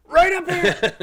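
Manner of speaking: very high, full-blast holler; imitated wild man. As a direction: scream the order like a manic director. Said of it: "he was just a wild man".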